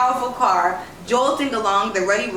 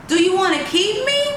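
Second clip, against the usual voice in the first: much higher, bright and eager; hopeful child; 10 semitones higher, 3 dB louder than usual. A narrator's reading. Girl eagerly asks the lady to keep her, much higher. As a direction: ask it full of sudden hope.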